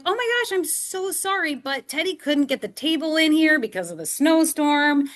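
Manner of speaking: high, bright-pitched mock apology from the friend; apologetic friend. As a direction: imitate Megan gushing sorry. Friend Megan apologizing, retold.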